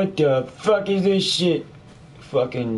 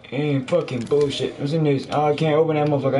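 ordinary talk, nothing marked

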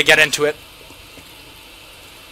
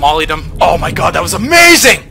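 excited shout; 2 semitones higher, 8 dB louder than usual. Real, not acted. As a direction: shout it out in delight. After killing enemies with a molotov.

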